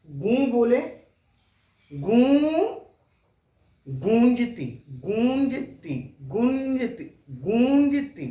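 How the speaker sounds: गूंजती with the ऊं ringing through both mouth and nose, loud; गूं said loudly with an open nasal vowel; nasal vowel demo. Shown as a demonstration. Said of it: "मुंह हमारा बंद नहीं हो रहा है".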